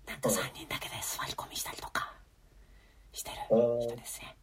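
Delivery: hushed unvoiced whisper, barely audible. Real, not acted. Telling the cohost about an awkward moment, whispered. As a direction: breathe it out so the host can hardly hear. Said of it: "ウィスパーすぎ".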